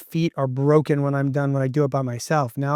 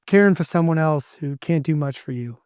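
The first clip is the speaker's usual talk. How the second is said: ordinary talk, nothing marked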